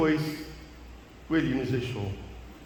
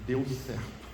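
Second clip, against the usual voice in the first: much softer, low and calm; quiet divine reply; 3 semitones lower, 7 dB quieter, much slower than usual. Retold or imitated. God's voice answering the pastor, soft and calm. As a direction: answer gently, like a voice from above.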